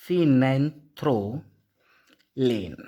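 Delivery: phenanthroline pulled apart very slowly, each syllable separate; teacher dictating the word very slowly. Shown as a demonstration. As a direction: break phenanthroline into slow, distinct syllables. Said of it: "सीड नहीं".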